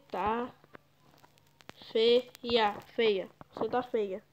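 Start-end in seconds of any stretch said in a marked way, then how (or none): none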